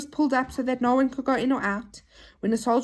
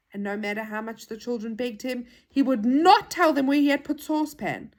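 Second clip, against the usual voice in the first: usual speaking voice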